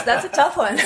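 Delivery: laughing through the answer; giggling, caught off guard. Real, not acted. Laughing at the awkward question.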